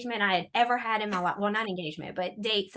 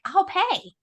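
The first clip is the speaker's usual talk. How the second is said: eager offer; 9 semitones higher, 5 dB louder than usual. Retold or imitated. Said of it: "the sister-in-law's like".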